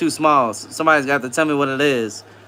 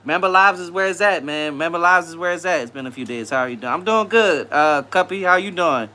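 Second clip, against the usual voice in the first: usual speaking voice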